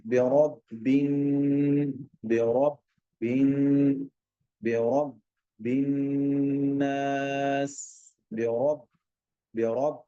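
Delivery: slow बेरब्बिन with a full heavy रा; drawn-out बेरब्बिन, the रा thick and full; slow, careful heavy रा. Shown as a demonstration. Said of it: "रा को पुर करना है".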